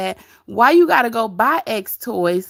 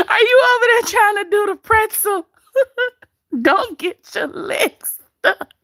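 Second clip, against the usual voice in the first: laughing; 10 semitones higher than usual. Real, not acted. Teasing viewer, still laughing.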